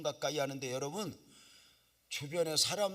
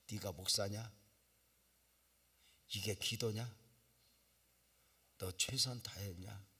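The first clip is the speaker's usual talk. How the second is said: a low, stern rebuke; rebuke from God; 4 semitones lower, 3 dB quieter than usual. Retold or imitated. God rebuking the pastor mid-prayer, low and stern. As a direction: quietly scold like an inner voice. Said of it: "여기서 책망이 오는 거야".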